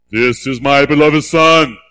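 a deep-set heavenly announcement; voice of God. Acted. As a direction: intone it as God speaking. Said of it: "You gotta have it, okay?".

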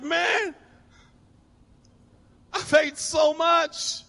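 slow, high, overfull moan; stuffed groan. Retold or imitated. Diner stuffed after dinner, slow high groaning complaint. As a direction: drag it out like you can barely breathe.

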